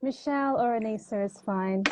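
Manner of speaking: ordinary talk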